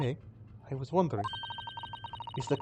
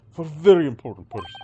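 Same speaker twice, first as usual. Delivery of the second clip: put-on louder proud Russian accent on very important persons; Russian-accented boast; 9 dB louder than usual. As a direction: puff up like a Russian guard. Sailor boasts about cabins.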